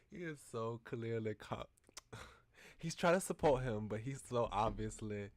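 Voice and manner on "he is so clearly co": ordinary talk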